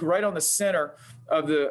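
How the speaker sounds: ordinary delivery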